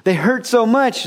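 high mock wail over hands and feet; mock whining complaint. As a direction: ham up a self-pitying moan about the pain. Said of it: "it's not that he was saying".

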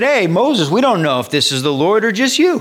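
high, loud panicky plea of the people; frightened crowd. Retold or imitated. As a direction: play the scared Israelites pleading. People voiced pleading with Moses.

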